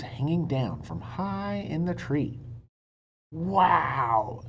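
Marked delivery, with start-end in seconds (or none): none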